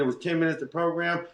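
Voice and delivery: ordinary talk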